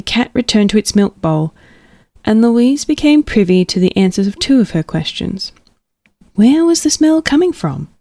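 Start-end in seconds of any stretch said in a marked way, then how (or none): 6.3-7.9 higher, wondering question; curious inner voice. Acted. Louise asking herself about the smell, higher curious voice. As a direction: lift it up like a puzzled mouse.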